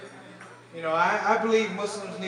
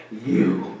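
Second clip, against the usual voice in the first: much lower, deadpan and menacing; low menacing one-word punchline; deep ominous voice; 11 semitones lower than usual. Retold or imitated. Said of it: "I looked at her and go".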